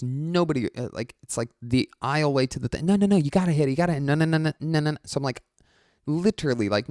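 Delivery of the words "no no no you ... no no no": higher, louder pushy stoner pleading; pushy insistent urging. Retold or imitated. Fan pushing a vape pen on the comic, high and insistent.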